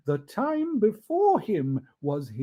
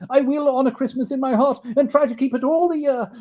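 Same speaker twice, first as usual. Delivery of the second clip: put-on high, pleading old miser; Scrooge pleading; 9 semitones higher, 7 dB louder, faster than usual. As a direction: beg the spirit for mercy, voice raised. Scrooge vowing to spirit.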